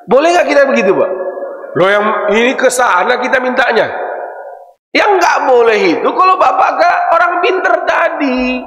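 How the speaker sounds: usual speaking voice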